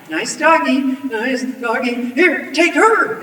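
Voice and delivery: high, loud, desperate placating; panicky. A narrator's reading. Villain offering the girl to the wolves, panicky. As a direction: let nervous coaxing climb into panic.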